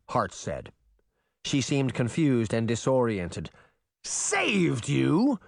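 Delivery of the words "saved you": higher, incredulous bellow. A narrator's reading. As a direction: throw it back at her in disbelief. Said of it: "Nottingham bellowed".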